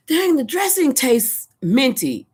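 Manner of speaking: high, slow drawn-out complaint; mock indignant exclaim. Tasting the store dressing, high slow indignant complaint.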